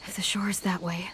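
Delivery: hushed unvoiced whisper while sneaking; whispering under cover. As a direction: keep it to a breath so no one hears. Sneaking out, checking coast is clear.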